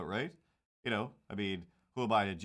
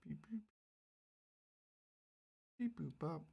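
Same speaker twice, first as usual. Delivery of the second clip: robot beeping; 9 semitones higher, 6 dB quieter than usual. Pretend. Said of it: "Have I been a robot yet".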